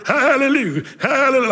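high, giddy hallelujahs with a laugh; laughing, high mock praise from the womb; mock baby praising. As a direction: play an unborn baby cheering in the womb. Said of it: "what does a baby filled with the Spirit in the mother's womb look like".

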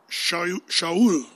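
"Shaul" with a sh sound, two syllables sha-ul; Hebrew pronunciation. Shown as a demonstration. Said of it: "you have to say".

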